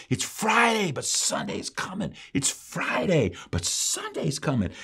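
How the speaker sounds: high-pitched punch on Friday, then dropping; worked-up refrain. Retold or imitated. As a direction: work the phrase like a fervent preacher. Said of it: "he just worked that phrase over and over".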